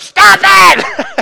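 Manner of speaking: mock yell from the wife, high and loud; retold shout. Wife in labor snapping at her coaching husband, loud shout. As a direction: shout it like an exasperated woman in labor.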